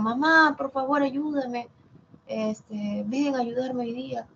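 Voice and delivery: a child calling mom for help, lightly acted. Teacher acting out asking mom for help, mild calling plea. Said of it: "cuando digo".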